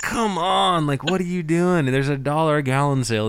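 high, loud mock exasperation at the seller; exasperated. Host ranting about overpriced tanks, loud exasperated.

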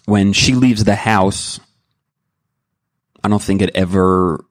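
ordinary delivery

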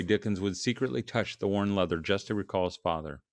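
ordinary delivery

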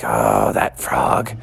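very low slow muttering; low exasperated aside; exasperated mom mutter. Acted. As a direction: grumble to yourself about the frog. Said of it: "she was like".

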